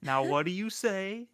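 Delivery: a slow, sing-song parental nudge; mom voice. Retold or imitated. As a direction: coax the kids like a mom reminding them of manners. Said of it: "Grace being, like, the mom of everybody".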